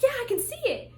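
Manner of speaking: high-pitched eager reply; retold thrilled agreement from dad; excited confirmation. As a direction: answer with rising excitement.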